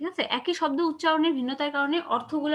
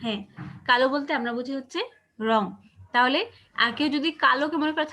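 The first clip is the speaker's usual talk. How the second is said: ordinary delivery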